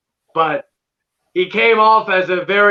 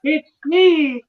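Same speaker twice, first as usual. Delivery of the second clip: [name] impression; 8 semitones higher than usual. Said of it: "[name]'s Kitchen".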